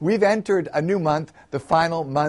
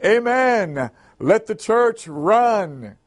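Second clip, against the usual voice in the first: excited deacon echoing in a high call; Deacon Mensa; 5 semitones higher, 3 dB louder, much slower than usual. A narrator's reading. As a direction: follow along with a raised, drawn-out cry. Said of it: "Deacon Mensa followed along with".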